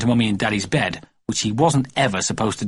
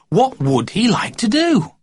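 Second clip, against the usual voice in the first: slow, higher, drawn-out wonder; 10 semitones higher, slower than usual. A narrator's reading. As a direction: let the question hang. Suspenseful narration.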